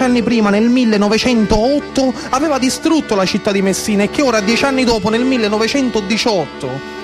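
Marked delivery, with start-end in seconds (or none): none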